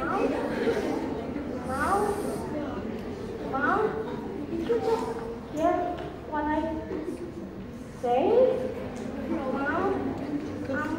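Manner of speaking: soft, halting questions with long pauses; tentative, shaken calling. Acted. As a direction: check timidly whether Mom heard. Son falters after his outburst.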